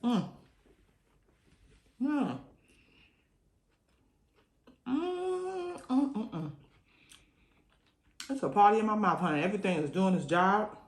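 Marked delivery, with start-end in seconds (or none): 4.9-6.5 high pleased mm of tasting; high savoring hums. Real, not acted. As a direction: hum with delight over the food. Savoring food sounds.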